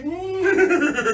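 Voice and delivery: high laughing neigh; whinny like a playful horse; kids' yoga horse sound. Shown as a demonstration. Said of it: "and everybody say".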